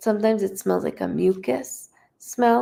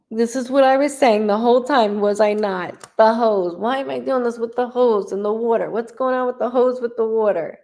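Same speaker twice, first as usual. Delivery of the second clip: higher-pitched, animated outburst of told-you-so; vindicated exclamation; 4 semitones higher, 3 dB louder than usual. Real, not acted. Medium exclaims to client over the hose, vindicated. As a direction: burst out higher, insisting on being proved right.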